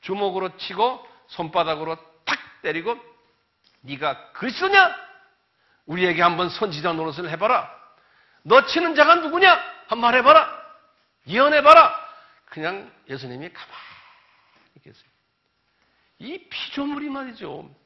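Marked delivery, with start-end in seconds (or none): 3.8-12.1 loud, much higher taunting; jeering mockery. Preacher voicing guards mocking Jesus, loud jeering. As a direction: taunt him, rising to a yell.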